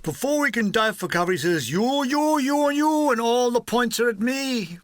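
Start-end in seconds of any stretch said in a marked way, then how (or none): 1.7-4.8 higher, picking each man out; singling men out for a work party; a touch of a gleeful sergeant major; a hint of a pointing sergeant major.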